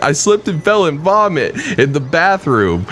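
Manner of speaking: imitated weepy drunk wail; mock drunk crying. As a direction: whine it like a tipsy friend pretending to cry. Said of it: "She's, like, drunk crying".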